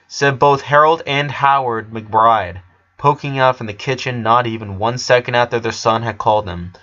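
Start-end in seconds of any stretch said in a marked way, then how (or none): none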